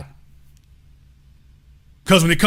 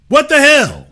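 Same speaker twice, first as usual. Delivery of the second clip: high, loud, stunned What the hell; loud disbelief; 9 semitones higher, 5 dB louder than usual. Real, not acted. Host repeating it, higher and louder in disbelief. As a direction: throw up your hands in shock.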